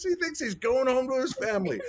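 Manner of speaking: put-on high kid voice through laughter; imitated mocking granddaughter. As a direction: mimic a child's taunt while cracking up. Repeats granddaughter's taunt, laughing.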